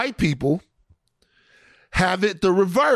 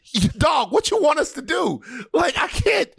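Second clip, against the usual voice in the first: higher, shouting in comic despair; exasperated yell; 8 semitones higher than usual. Real, not acted. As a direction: throw up your hands and holler. Exasperated comic outburst at offenders.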